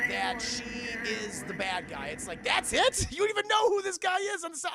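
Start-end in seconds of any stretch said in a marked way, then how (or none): 2.4-4.7 loud, high, incredulous outburst; mock disbelief. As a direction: protest in loud, pitched-up disbelief. Mock disbelief at the flimsy evidence.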